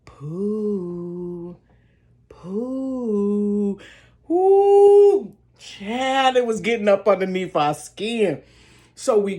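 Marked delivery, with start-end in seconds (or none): 0.0-3.8 ghostly call. Retold or imitated. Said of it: "they still saying". 4.3-5.3 high squealing ooh; excited ooh. Retold or imitated. Reacting to scare.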